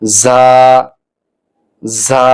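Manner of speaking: separate, drawn-out "ঝা", pronounced za; Arabic letter demonstration. Shown as a demonstration. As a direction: hold each "ঝা" with a voiced za sound. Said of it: "দাঁতের গোড়ার সঙ্গে লাগাতে হবে".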